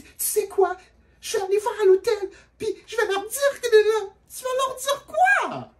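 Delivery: very high, loud mock sob; mock crying girl. Storyteller imitating the girl sobbing at him. Girl's crying threat voiced.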